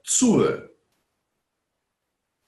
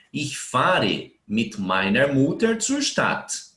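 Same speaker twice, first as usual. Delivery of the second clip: slow model sentence; slower than usual. Shown as a demonstration. Teacher reads example aloud.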